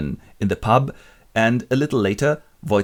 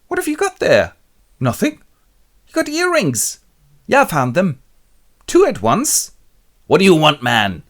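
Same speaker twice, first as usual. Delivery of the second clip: much higher, louder bickering voices; high mock quarrel; 8 semitones higher, 6 dB louder than usual. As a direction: play both sides of a spat. Acted jealous quarrel.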